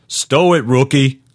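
high-pitched, curt command; barked order. Acted. Senior guard ordering a rookie to stop, barked. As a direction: pull the rookie up sharply, pitch jumping. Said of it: "Mark barked the order".

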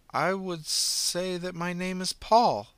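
slow uncanny declaration; eerie declaration. Acted. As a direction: announce it strangely and slowly. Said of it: "she declared".

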